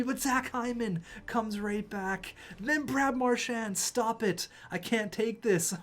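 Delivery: begging the game to stop; anguished complaint. Real, not acted. Gamer pleading with the game in high-pitched anguish.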